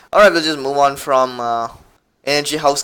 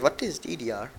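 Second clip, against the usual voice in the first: ordinary delivery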